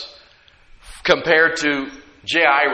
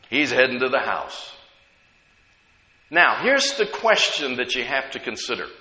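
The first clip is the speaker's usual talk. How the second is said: ordinary delivery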